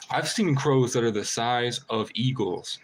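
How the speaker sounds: ordinary talk, nothing marked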